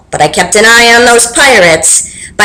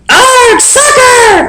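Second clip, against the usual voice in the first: very high, squealing yell; pirate crew yelling, much higher; 14 semitones higher, much slower than usual. Acted. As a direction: shriek it as a crew. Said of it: "the crew yelled".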